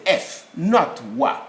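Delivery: F named firmly against a rejected WA; slow, emphatic "F, not wa"; sound contrast. Shown as a demonstration. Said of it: "what do I say?".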